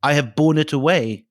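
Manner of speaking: a measured storyteller's cry; cried out. A narrator's reading. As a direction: proclaim it with conviction, voice lifted. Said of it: "he cried out".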